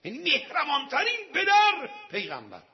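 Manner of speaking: high, loud sermon climax; impassioned preaching. Real, not acted. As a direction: proclaim the praise at full force. (خطابهٔ پرشور با صدای بلند)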